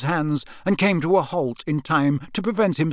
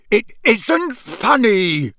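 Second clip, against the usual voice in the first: much higher, slow, louder furious father; an outraged father cutting off laughter; shouted; 8 semitones higher, 5 dB louder, much slower than usual. A narrator's reading. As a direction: shout each word, slow and sharp. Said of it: "Mister Weasley shouted".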